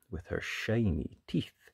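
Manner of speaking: plain reading voice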